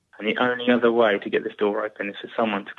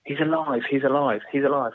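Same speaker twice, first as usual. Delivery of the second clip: raised-pitch, repeated alarm call; retold screaming; 5 semitones higher than usual. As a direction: imitate someone crying out in panic at a contained volume. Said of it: "I could hear screaming".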